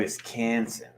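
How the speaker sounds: a put-on Wisconsin accent that slides toward Boston; Wisconsin accent try. Shown as a demonstration. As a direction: attempt a Wisconsin accent and miss a little. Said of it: "I keep sounding like Boston".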